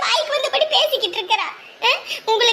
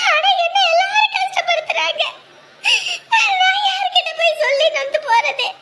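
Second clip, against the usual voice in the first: crying out; 7 semitones higher than usual. Acted. Mother to her son-in-law, crying out in tears. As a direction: cry out the hurt, voice breaking. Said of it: "ஐயோ, அழாதீங்க".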